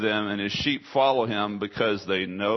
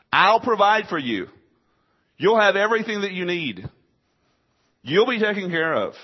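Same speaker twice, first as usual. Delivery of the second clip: higher, louder voicing of the shepherd's promise; 7 semitones higher, 5 dB louder than usual. Pastor voicing the shepherd promising his sheep, higher and louder. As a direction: proclaim it like a shepherd calling his flock. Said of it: "This is the voice of a shepherd".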